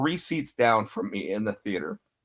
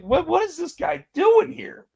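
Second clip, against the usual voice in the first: incredulous. Retold or imitated. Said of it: "I was like".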